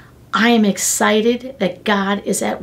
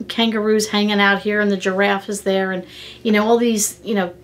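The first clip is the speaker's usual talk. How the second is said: ordinary delivery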